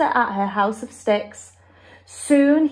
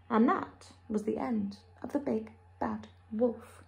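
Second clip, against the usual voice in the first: hushed narration; 2 semitones lower, 10 dB quieter than usual. A narrator's reading. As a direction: drop to a solemn hush. Hushed end of the wolf.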